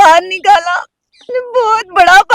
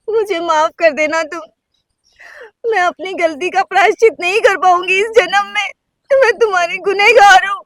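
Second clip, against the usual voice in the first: crying plea. Acted. Wife sobbing to husband, begging forgiveness for her sin.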